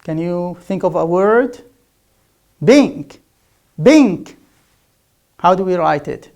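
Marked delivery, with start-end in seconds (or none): 2.6-4.4 loud "Bink" for pink; punched B in "Bink" where P belongs. Shown as a demonstration. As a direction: mispronounce pink as "Bink", voiced B. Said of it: "as B".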